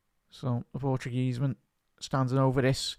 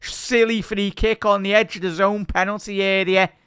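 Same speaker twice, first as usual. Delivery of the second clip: high, loud play-by-play; live commentary; 7 semitones higher, 7 dB louder than usual. Acted. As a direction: commentate the replay with energy. Play-by-play after goal.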